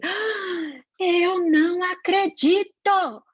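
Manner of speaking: high, loud, drawn-out mock outrage; gasp, then stretch out each word in acted fury; furious outburst. Mother finding the painted wall, acting furious. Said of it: "ela ficou possessa, foi aquele".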